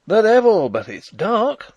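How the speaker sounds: high, loud, ringing curse; ringing cry. Acted. Young Rupert cursing the darkness during the ambush, loud ringing cry. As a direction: fling it out boldly into the night. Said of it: "cried a ringing voice".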